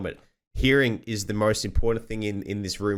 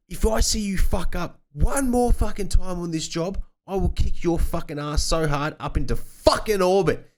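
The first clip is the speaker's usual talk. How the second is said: furious foreman threat; 10 semitones higher, 3 dB louder than usual. Retold or imitated. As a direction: bellow the threat like an enraged foreman. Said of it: "he just lost his shit".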